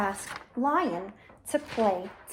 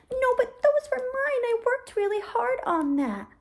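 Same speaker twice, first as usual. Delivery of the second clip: upset turtle; 8 semitones higher than usual. Acted. Turtle protests losing toys.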